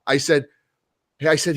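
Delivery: ordinary talk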